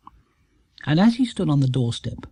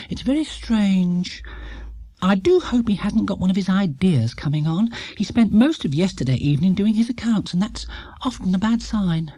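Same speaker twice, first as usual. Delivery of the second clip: higher, anxious motherly voice; a lightly voiced worried mother; worried Mrs Brown; 5 semitones higher than usual. A narrator's reading. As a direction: fret that he's up to something.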